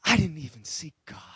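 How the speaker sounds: breathless whispered realization. Acted. Preacher whispering his own realization to the congregation. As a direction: whisper it as if caught out.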